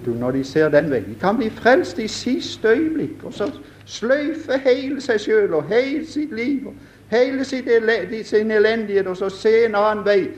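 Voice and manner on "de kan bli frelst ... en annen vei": fervent preaching. Acted. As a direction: lift it up and hold the fervour. Preaching at full force.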